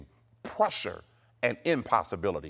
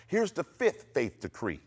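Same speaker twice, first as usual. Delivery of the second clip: normal talking voice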